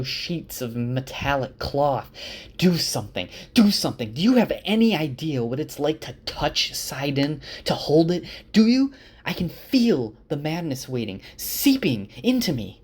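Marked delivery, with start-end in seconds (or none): 2.6-12.8 angry tirade. Retold or imitated. Rand raging at Egwene and Elaine.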